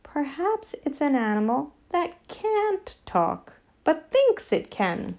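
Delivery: lightly voiced donkey, high-pitched; bright donkey. A narrator's reading. Donkey offering a theory about the animal, mildly bright. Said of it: "said the donkey brightly".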